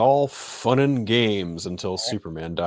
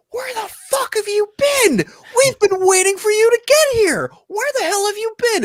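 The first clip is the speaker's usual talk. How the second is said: much higher, slow, shouted scolding; mock friends yelling; 7 dB louder than usual. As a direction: holler across the theater. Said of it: "everyone I know is like".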